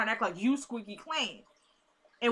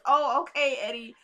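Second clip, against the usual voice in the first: mock-meek, higher-pitched agreement; meek pushover; 4 semitones higher, 4 dB louder than usual. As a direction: lightly imitate a pushover caving in. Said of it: "like the punk person that he is".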